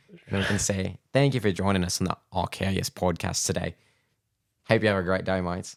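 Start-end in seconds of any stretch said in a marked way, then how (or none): 1.1-5.8 hammed-up Aussie podcast sign-off; mock Aussie accent. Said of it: "I'll think about Sheila and then the shrimp on the bobby".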